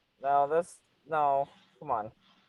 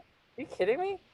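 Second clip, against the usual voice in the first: incredulous; 10 semitones higher, 6 dB quieter than usual. Real, not acted. Amazed at her dates.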